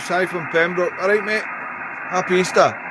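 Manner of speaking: normal talking voice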